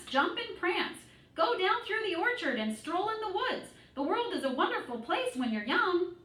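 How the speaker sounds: high, excited goose egging on a pig. A narrator's reading. Goose urging Wilbur to explore the world, high and eager.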